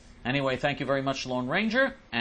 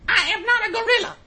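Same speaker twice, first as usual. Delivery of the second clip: much higher, louder offended voice; mock indignant supervisor; 8 dB louder than usual. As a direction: ham up her outrage. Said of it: "said, Well, Attila the gorilla".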